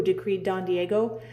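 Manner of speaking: usual speaking voice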